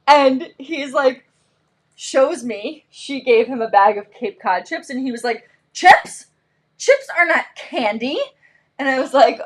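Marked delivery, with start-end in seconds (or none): 5.7-8.2 high-pitched imitation of an offended kid; indignant child disbelief. Son shown a bag of chips, indignant disbelief. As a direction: act out a kid's appalled protest.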